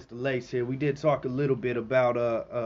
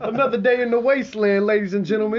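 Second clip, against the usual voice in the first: high, loud hyped radio DJ; whooping wasteland radio host; Three Dog radio DJ; 11 semitones higher, 9 dB louder than usual. Pretend. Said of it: "I'm trying to be like Three Dog".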